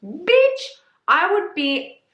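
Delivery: Bitch squealed way up in disbelief; a sudden shocked high yelp; outraged squeal. Real, not acted. Reacting to the arranged marriage trope, outraged squeal.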